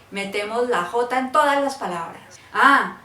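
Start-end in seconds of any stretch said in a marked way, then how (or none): none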